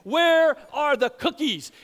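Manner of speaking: loud, slow mock furious demand; hammed-up angry dad bellowing; mock rage. Dad demands cookies from kids in mock rage.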